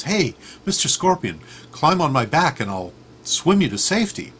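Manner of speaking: high, bouncy offer of help; eager frog. Acted. Frog offering a stranded scorpion a ride, eagerly. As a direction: call out brightly as a helpful frog.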